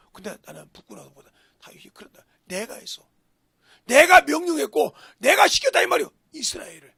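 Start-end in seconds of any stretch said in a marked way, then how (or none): 2.4-6.1 bold confession. Acted. Voices David's confession.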